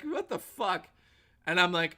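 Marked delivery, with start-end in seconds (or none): 0.0-0.9 high, startled "What the fuck?"; a pitched-up yelp of disbelief; mock shocked. Edgar Wright shocked by reframe request.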